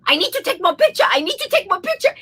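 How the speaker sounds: high, insistent mock Japanese accent. Japanese passenger pushing past the pilot, high Japanese accent. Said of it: "the Japanese woman is going".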